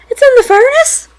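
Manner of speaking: high, loud disbelieving question; shocked question. Real, not acted. Player realising the voice is inside, high alarmed question. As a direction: shoot the pitch up in horror. Hearing voice from furnace.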